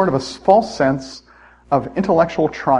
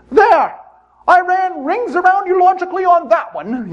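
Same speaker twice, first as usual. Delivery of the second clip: loud, much higher falsetto gloat; triumphant old lady; 4 dB louder than usual. Retold or imitated. Old woman gloats over her friend in a high falsetto. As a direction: crow your win like a Python old lady. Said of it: "she says".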